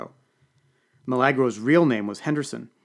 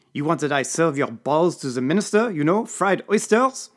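put-on menacing French accent; 5 semitones higher, 6 dB louder than usual. A narrator's reading. Serge threatens the cringing chef, French accent. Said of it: "Serge threatens chef".

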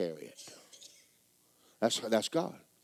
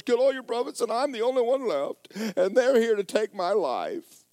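high, louder self-pitying whine; whining Elijah; 11 semitones higher, 6 dB louder than usual. Acted. Elijah pleading to God that he alone is left. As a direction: plead your case like a victim.

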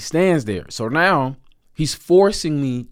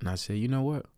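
ordinary talk

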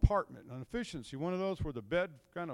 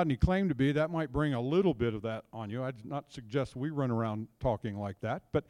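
ordinary talk, nothing marked

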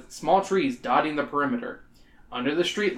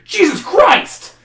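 high, slow, louder yelp of fright; startled yell; 9 dB louder, much slower than usual. A narrator's reading. Startled woman yelling as a cat darts past her, high and loud. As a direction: jump out of your skin and yell it. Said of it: "the woman yells, startled".

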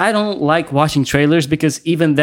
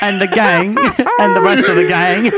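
mock hero voice; 5 semitones higher than usual. Mocking Spider-Man trailer voice.

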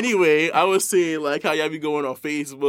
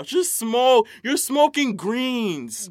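higher, punchy scolding; heated rant; 4 semitones higher than usual. Real, not acted. Scolding stoners who brag about weak weed, heated and high. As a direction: call them out, stretching each word.

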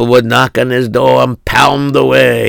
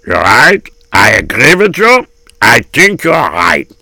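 put-on old crony voice; agreeing gabbai. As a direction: agree solemnly in an old-world accent. Second gabbai agrees in character.

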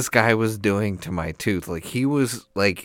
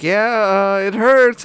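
mock pained whine; 13 semitones higher, 10 dB louder, slower than usual. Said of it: "And you're like".

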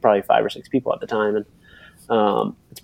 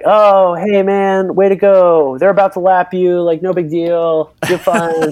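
mock friendly heckle; 7 semitones higher, 3 dB louder, slower than usual. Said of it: "these guys are like".

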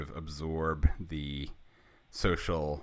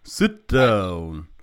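loud, higher-pitched mock-solemn announcement; Alf; 8 semitones higher, 10 dB louder than usual. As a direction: play a grave announcement, loud and hammed-up. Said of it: "he's like".

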